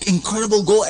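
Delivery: ordinary delivery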